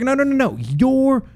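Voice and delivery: high, loud mock excited teen; excited girl. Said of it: "She's like".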